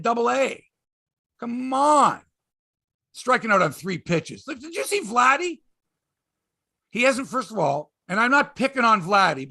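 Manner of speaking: normal talking voice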